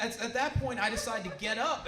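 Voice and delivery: ordinary talk, nothing marked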